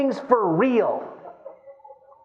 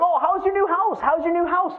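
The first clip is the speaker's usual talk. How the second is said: eager; 9 semitones higher than usual. Retold or imitated. Mimicking friends' eager questions.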